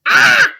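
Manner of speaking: short, high, very loud yell; angry scream. Shown as a demonstration. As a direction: burst out with a sharp scream. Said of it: "Sometimes you scream when you're angry".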